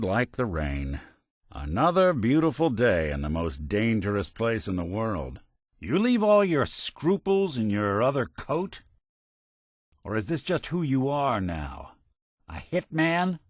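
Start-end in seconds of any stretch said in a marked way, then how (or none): 5.8-13.4 higher-pitched, pointed questioning; disapproving reproach. Acted. Confronting a companion about their lost scruples, disapproving. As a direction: challenge them with rising, incredulous questions.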